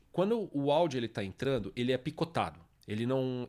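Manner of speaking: ordinary talk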